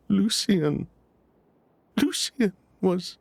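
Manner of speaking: choking up over the name Lucien; voice breaking. Acted. Sylvain recalling finding his brother dead, voice breaking.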